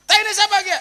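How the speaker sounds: loud, high yell; teriak. Acted. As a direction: yell it out in disgust. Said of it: "Orang ngapak kan teriak". (teriakan keras tinggi)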